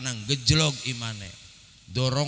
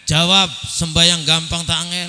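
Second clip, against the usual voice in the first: loud call for an answer; preacher's challenge; 4 semitones higher, 12 dB louder than usual. Real, not acted. Preacher quizzing the congregation on prayer, loud call-out. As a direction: demand a response from the crowd.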